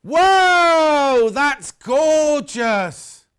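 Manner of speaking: loud, high burst of delight, then drawn out slow; excited gasp. Real, not acted. Seeing the new blue fabric, a loud amazed whoa. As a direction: gasp in wonder, then linger on gorgeous.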